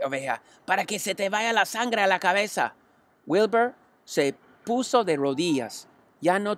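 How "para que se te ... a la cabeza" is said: a lightly voiced wise old sheep; calm old ewe giving advice; old sheep advising. A narrator's reading. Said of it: "murmuró la oveja".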